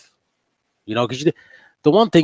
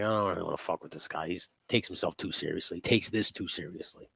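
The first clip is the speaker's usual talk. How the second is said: dismissive mutter; 4 semitones lower, 11 dB quieter than usual. Retold or imitated. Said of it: "people turn around and tend to go like".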